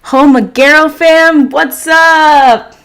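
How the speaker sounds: loud, high, drawn-out hail; exuberant greeting. Real, not acted. Streamer greeting fans joining the stream, exuberant loud shout-out. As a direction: holler it to the whole crew.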